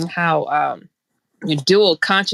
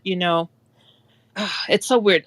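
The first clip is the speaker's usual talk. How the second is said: normal talking voice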